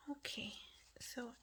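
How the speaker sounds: breathy hushed okay; whisper. Real, not acted. Crafter unboxing a gift, whispering to herself. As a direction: drop to a private whisper.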